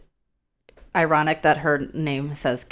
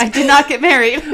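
laughing; 8 semitones higher, 7 dB louder than usual. Real, not acted. Laughing at own breakup spoiler.

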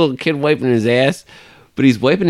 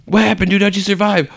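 high frantic mock panic; panicked survivor; 10 semitones higher than usual. Imagining a zombie apocalypse survivor, high mock panic. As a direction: gasp it out like running for your life.

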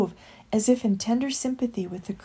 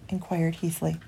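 plain reading voice

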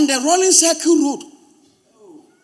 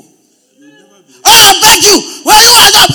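higher, louder begging cry; mock shouting plea; 8 semitones higher, 13 dB louder, much slower than usual. Pastor mimics a man shouting his begging, loud and pleading. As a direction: beg loudly, hammed-up. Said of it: "seen him shouting, begging before".

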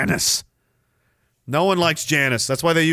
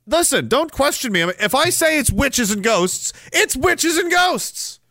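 mock insistent; 8 semitones higher than usual. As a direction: play the know-it-all who won't be questioned. Mock insistence about ghosts.

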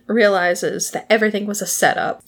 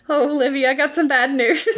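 mock sad news, laughing; 5 semitones higher, faster than usual. Buttons tells widow bad news.

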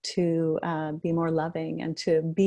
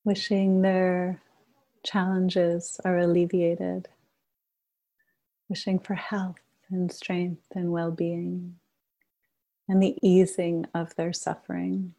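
guided meditation. Acted. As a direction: gently guide the group into shared stillness. Leads a group meditation.

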